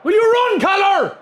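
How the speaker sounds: high, loud angry yell at the screen; frustrated shout. Real, not acted. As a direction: shout in exasperation. Yelling at lazy player.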